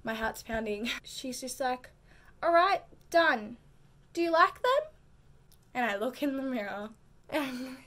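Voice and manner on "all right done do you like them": brisk beautician call, higher and louder. Retold or imitated. Beautician voiced loud and high.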